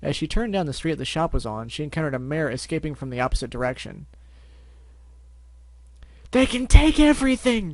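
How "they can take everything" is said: high, loud panicked cry; panicking. Acted. Fleeing shop mare panicking as customers clean her out. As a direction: shriek it in a panic. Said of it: "she was panicking".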